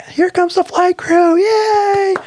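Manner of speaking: very high, slow, sing-song cheer; mock cheering crowd. Terminal volunteers greeting the crew, high squealing cheer. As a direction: squeal it out like a welcoming fan.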